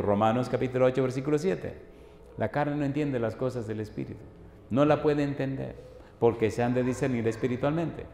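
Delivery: ordinary talk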